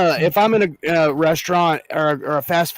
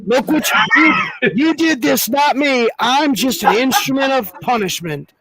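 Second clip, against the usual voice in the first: higher-pitched accusing protest; mock righteous blame; 8 semitones higher than usual. Avenger lecturing a foot-dragger, mock righteous blame. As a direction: act out a self-appointed punisher scolding the culprit. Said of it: "he would just be like".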